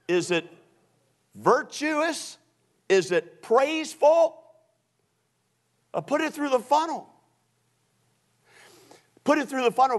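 loud, high, ringing sermon delivery; full-force preaching. Acted. Preacher urging the congregation to change their thinking, full-force preaching.

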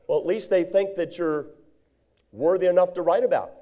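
normal talking voice

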